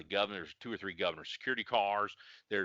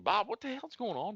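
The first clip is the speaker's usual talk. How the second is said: higher, demanding boss on phone; mock angry phone call; 12 semitones higher than usual. Sergeant phoning prosecutor Bob, higher demanding tone. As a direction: demand answers down the line.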